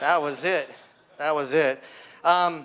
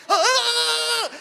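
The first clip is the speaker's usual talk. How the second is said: high shocked yelp; a sudden jolt scream; shrill cry of a man getting shocked; electrocuted scream; 13 semitones higher than usual. Retold or imitated.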